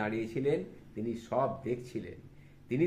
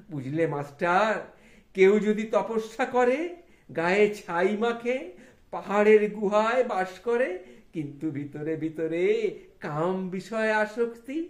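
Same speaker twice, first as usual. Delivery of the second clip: raised, laughing village saint teaching; Thakur preaching; 9 semitones higher, 7 dB louder than usual. Acted. Thakur teaching the schoolmaster about vidya, raised and earnest. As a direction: lecture the master with playful fervour.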